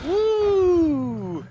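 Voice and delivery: loud, high celebratory whoo; long high cheer; high whoop. Real, not acted. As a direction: let out a gleeful high whoop. Whoop after dodge.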